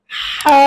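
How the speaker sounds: হা pressed from the throat, a tight breathy h; throaty ha. Shown as a demonstration. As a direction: squeeze হা from the throat. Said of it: "গলায় চাপ দিয়ে বলুন". (গলায় চাপ দিয়ে হা)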